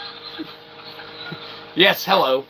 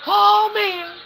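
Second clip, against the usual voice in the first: squeaky voice; 4 dB louder, slower than usual. Acted. High squeaky character voice bit.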